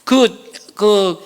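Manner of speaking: ordinary talk, nothing marked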